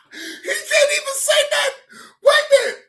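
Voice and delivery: high, loud laughing shout; excited shout. Real, not acted. Telling the stream, shouting high and excited. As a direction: yell it out through the laughter.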